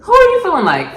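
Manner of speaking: high, loud outraged question; indignant outburst. Real, not acted. Vlogger scolding the character, high and loud outrage. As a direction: demand it, scandalised.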